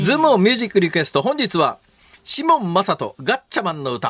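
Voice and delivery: high, fast announcer intro; radio announcer call. Acted. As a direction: announce the request like a jingle. Radio song request title call.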